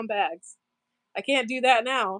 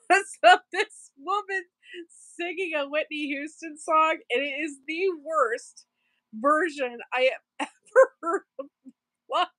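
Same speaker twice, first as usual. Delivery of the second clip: higher voice, breaking up with laughter; real laughing while talking; 6 semitones higher than usual. Real, not acted. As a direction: struggle to finish the story through laughs. Cracks up describing awful singing video.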